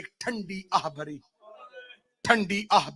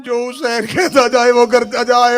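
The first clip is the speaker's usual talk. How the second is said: emphatic repeat; 4 semitones higher, 9 dB louder than usual. Acted. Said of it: "پہلی اور آخری بار کہہ رہی ہوں".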